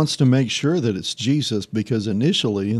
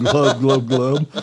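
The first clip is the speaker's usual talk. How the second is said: glub glub gurgle; 4 dB louder, slower than usual. Retold or imitated. Peter sinking, joked.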